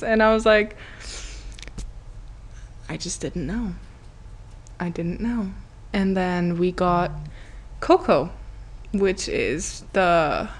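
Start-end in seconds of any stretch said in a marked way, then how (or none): 2.9-5.5 small, soft mock-sheepish voice; softly acted sheepishness; meek excuse. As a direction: offer a quiet excuse to mom. Her meek excuse to mom, retold.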